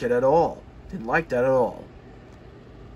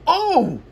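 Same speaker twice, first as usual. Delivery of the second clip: high loud pained "Oh!"; groan; 13 semitones higher, 6 dB louder than usual. Acted. Coaches bumped roughly, high pained groan. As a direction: coaches jolted, crying out. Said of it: "groaned the coaches".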